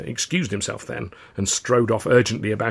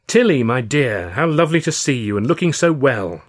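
toothy false charm; 4 semitones higher, 5 dB louder than usual. A narrator's reading. Charlie greeting Tilly with a fake smile, gushing. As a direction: gush with a plastered-on smile. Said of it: "plastered his biggest, toothiest smile".

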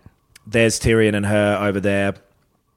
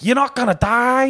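strained, very high mock northern voice; badly attempted northern cry, pitched way up; thick northern accent; 13 semitones higher than usual. Brother refuses sister's death, hammed-up high northern accent. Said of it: "I cannot do that voice".

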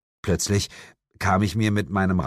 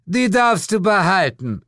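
high, louder, magnanimous grant; patronizing; 12 semitones higher, 5 dB louder than usual. A narrator's reading. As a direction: grant it grandly, as a favour. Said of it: "erklärte sie gönnerhaft". (gönnerhaft)

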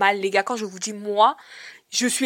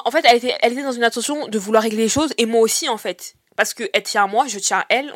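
ordinary talk, nothing marked

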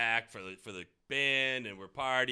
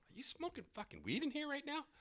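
incredulous quote; 6 dB quieter than usual. Retold or imitated. Coworker confronting him.

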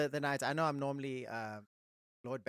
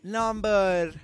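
slow, stretched-out podcast announcer; show announcer intro; 5 semitones higher, much slower than usual. Acted. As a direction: draw out the big episode announcement with a fading echo.

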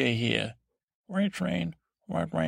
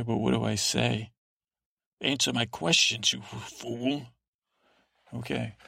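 normal talking voice

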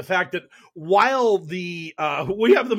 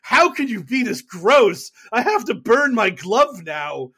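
higher, louder, slow mock disgust; disgusted prosecutors; 5 semitones higher, 6 dB louder than usual. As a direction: recoil in horror at the slime. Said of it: "They're just like".

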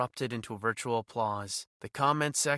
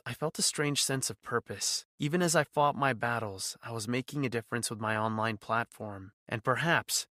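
usual speaking voice

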